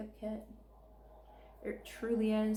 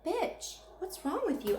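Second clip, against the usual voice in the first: exasperated scolding; 8 semitones higher than usual. Real, not acted. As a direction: pitch jumps way up on Bitch, incredulous. Scolding Aurora directly.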